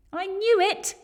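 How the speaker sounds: very high, loud whoop; whoop of delight. A narrator's reading. Boy learning the Yeti loves spaghetti, high loud whoop. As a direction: burst out with triumphant glee. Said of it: "with a whoop of delight".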